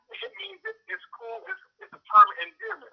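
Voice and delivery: high, breezy imitation of a teen; mock young kid. Kid explaining the word to coach.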